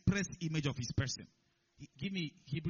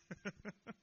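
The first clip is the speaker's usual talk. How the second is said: soft, short chuckle; 4 semitones higher, 11 dB quieter than usual. Real, not acted. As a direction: chuckle quietly to yourself. Amused at the retold collapse-and-die claim.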